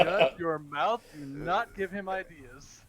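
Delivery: loud, slow, emphatic order; high, loud, drawn-out telling-off; mock scolding. Real, not acted. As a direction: shut a friend down, stretching each word. Mock scolding of a fellow player.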